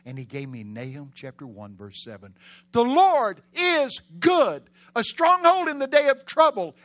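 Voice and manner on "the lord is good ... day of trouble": high, loud, slow drawn-out proclamation; soaring preacher's shout; preaching at full force. Real, not acted. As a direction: cry the verse out to the crowd.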